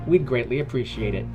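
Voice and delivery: plain reading voice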